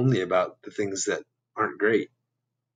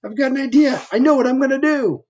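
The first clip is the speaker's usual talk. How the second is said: high, bright eager thinking voice; a sudden idea, voiced high; high eureka voice for Bob; Bob's excited thought; 13 semitones higher, 4 dB louder than usual. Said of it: "He was thinking".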